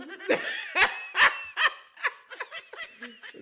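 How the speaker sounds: loud, high-pitched burst of laughter; high cackling laugh. Real, not acted. Host laughing at Cowboys fans, loud high cackle. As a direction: howl with laughter.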